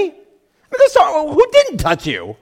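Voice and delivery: very high, loud exasperated disbelief; hammed-up, squeaky-high bafflement; incredulous disciples. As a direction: protest as if it's a dopey question. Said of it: "thought it was a dopey question".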